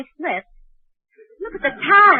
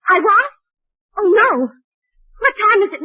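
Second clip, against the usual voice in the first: higher and louder, jolted awake; startled alarm; 6 semitones higher, 7 dB louder than usual. Acted. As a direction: snap awake in a panic. Wakes up, realises she overslept.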